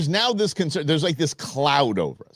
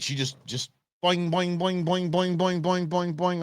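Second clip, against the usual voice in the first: boing sounds; 3 semitones higher, 4 dB quieter than usual. Retold or imitated. Imitating wife bounding uphill.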